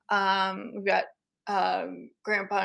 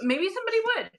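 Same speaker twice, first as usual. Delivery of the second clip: high sing-song; 11 semitones higher, much faster than usual. Real, not acted.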